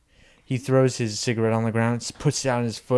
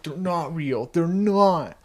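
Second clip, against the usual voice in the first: higher, whimpering denial; mock crying; 6 semitones higher, slower than usual. Grown son alone in his car, mock tearful denial. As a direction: whimper it as if fighting tears.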